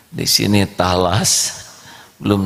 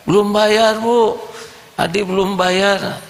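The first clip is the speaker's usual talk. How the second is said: put-on child's voice; 13 semitones higher than usual. Boy tells mother his friend hasn't paid, high child voice. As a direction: explain like an earnest little boy.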